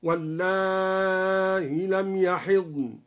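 slow chant, long "واللائي" ending in softened hamza without ya. Shown as a demonstration. Sheikh demonstrates al-Duri's reading, long madd on "واللائي" with softened hamza. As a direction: draw out "واللائي", ease the hamza. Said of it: "بحذف الياء وتسهيل الهمزة مع المد".

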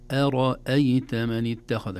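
slow recitation, أرأيت with thin hamza and heavy ra; light hamza. Shown as a demonstration. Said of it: "همزة مرققة ثم راء مفخمة".

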